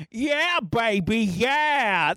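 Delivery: slow, drawn-out Austin Powers purr; slow hammed-up groovy drawl; Austin Powers impression. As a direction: stretch out the yeah baby like Austin Powers. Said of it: "They fucking show Austin Powers".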